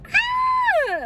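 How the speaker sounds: high excited shriek of relief; ecstatic shriek. Retold or imitated. Telling viewers she got away, a high ecstatic shriek. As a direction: shriek with joy at getting away with it. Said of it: "me being ecstatic".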